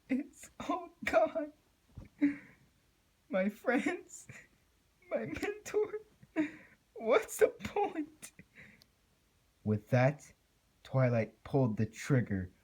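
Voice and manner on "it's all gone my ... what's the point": very high, slow, tearful voice; crying despair. A narrator's reading. Twilight grieving her friends before the end, slow and crying. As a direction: choke it out through tears.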